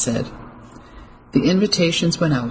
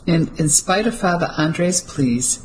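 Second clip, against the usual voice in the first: ordinary delivery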